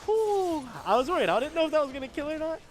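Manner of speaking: loud relieved exhale and fast rush. Real, not acted. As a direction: blow out relief, then rush the words. Relief after a close round.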